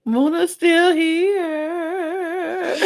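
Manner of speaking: slow, high drawn-out announcement; excited. Real, not acted. Cohost thrilled her favorite survived, drawn-out high. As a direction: stretch it out in triumph.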